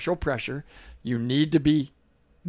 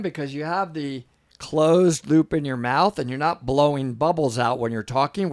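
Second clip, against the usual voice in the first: ordinary talk, nothing marked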